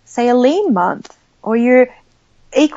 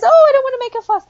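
much higher, louder and fast, a hammed-up polite protest; mock mother-in-law voice; 5 dB louder, faster than usual. Mother-in-law refusing to send back cold steak, in a high hammed-up voice. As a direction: squeak it out like a fussy mother-in-law who won't complain. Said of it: "She goes".

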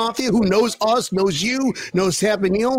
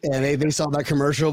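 ordinary delivery

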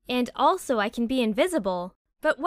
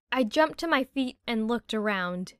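plain reading voice

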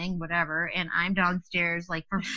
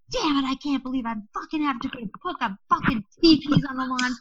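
high-pitched frustrated rant, fast at first; angry rant; 7 semitones higher than usual. Retold or imitated. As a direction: fume through the swearing, higher. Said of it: "So I storm downstairs".

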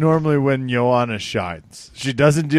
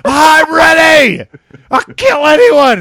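huge high, drawn-out shout; hammed-up maniac battle yell; roided-up Cyborg; 10 dB louder, slower than usual. Co-host mocking a fighter hyped on steroids, hammed-up yell. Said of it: "maybe Cyborg hears this conversation".

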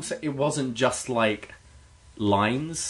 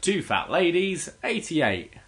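sing-song call, pitched up; bingo caller; 6 semitones higher, slower than usual. Retold or imitated. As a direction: announce the number like a bingo caller. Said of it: "back home it's like".

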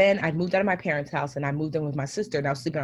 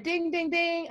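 chime imitation; 10 semitones higher, 3 dB quieter, much slower than usual. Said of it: "it said".

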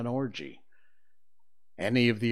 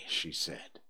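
ordinary delivery, nothing marked

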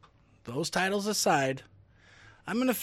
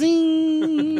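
zing sound effect; 11 semitones higher, 8 dB louder than usual. Retold or imitated. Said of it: "he was like".

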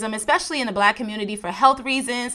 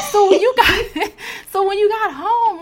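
laughing; 10 semitones higher, 4 dB louder than usual. Real, not acted. Host laughs at the boyfriend story, laughing. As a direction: laugh your way through the question.

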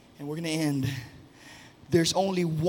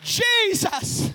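shouted high over music; soaring sermon shout; full-force preaching; 12 semitones higher, 3 dB louder than usual. Acted. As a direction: preach it out to the rafters.

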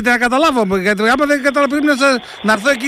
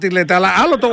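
ordinary talk

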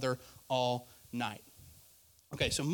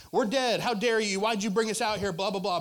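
higher, louder whiny grumble; mock complaining Israelites; 5 semitones higher, 5 dB louder than usual. Preacher mimics complaining people, whiny mock protest. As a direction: mimic a crowd griping at Moses. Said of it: "groaning and complaining and they're like".

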